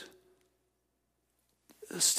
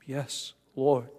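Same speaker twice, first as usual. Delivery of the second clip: tearful; 4 dB quieter than usual. Retold or imitated. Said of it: "Can you hear the tear in his voice".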